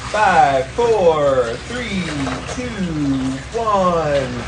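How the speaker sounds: counting down loud and tense; loud countdown. Real, not acted. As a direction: call out the count, bracing. Counting down in ice bath.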